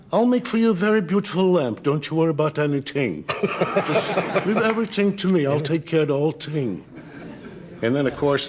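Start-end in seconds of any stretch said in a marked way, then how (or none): 0.1-6.8 high-pitched, effusive Yiddish dialect. Shown as a demonstration. Voice actor demonstrates a customer-soothing fellow, high camp Yiddish accent.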